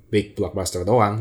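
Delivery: normal talking voice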